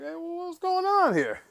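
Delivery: high, slow, puzzled "what is this"; mock bewildered, drawn out; baffled reaction. Reacting to Joel's accent in the promo, stretched high and baffled. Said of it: "And I'm like".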